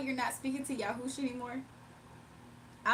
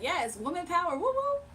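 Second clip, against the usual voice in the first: higher, mock girl-power cheer with a whoop; 7 semitones higher, 4 dB louder than usual. Streamer voices girls backing woman power, mock cheering. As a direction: cheer it on playfully.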